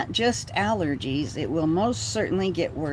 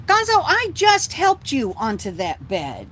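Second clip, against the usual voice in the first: higher, louder exasperation; exasperated; 7 semitones higher, 5 dB louder than usual. Real, not acted. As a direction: scold the dog in disbelief. Scolding the dog.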